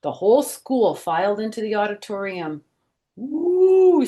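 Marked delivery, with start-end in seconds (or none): 3.2-4.1 high, loud excited woo; long rising crowd woo; kids cheering woo. A narrator's reading. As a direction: cheer as the lights go out. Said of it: "said the kids".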